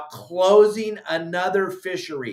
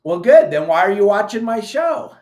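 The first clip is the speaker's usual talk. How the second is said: loud retort; 4 semitones higher, 9 dB louder than usual. Real, not acted. Sarcastic retort to a critic.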